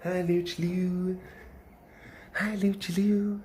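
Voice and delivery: soft high cooing hello. Real, not acted. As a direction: coo a tender greeting. Greeting the bird.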